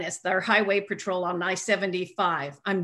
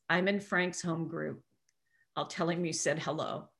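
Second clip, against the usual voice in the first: tender message; 4 semitones lower, 8 dB quieter than usual. Retold or imitated. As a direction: pass it on gently and quietly. Retold woman's moving message about Frank.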